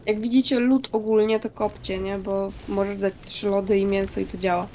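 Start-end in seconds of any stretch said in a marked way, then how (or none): none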